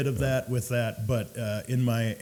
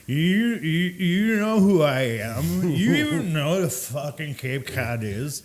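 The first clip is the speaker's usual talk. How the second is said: put-on drunk customer; 5 semitones higher, 4 dB louder than usual. Drunk refused a drink challenges the bartender, exaggerated indignation. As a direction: stretch it out like an affronted drunk. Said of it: "the super intoxicated".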